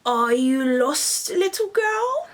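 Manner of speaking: slow, then high and sweet; fake-friendly wolf luring her; smiling wolf.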